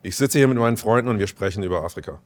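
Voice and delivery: quick, fluent, unexaggerated German; smooth native German. Shown as a demonstration. German actor translating for the hosts, smooth quick native German. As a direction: rattle off the German smoothly and lightly. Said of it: "it's smooth".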